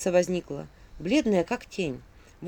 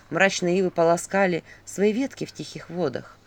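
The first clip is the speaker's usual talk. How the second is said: ordinary delivery, nothing marked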